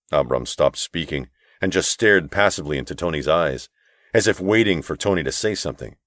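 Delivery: plain reading voice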